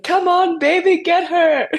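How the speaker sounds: put-on high, loud cheering in a French accent; French accent yell. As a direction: yell encouragement like a French teammate. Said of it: "in her French accent".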